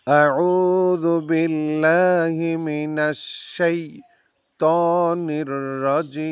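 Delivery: slow, melodic tajweed recitation; Quranic recitation. Shown as a demonstration. Teacher reciting for students to repeat, slow and melodic. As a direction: chant it slowly, drawing out the endings.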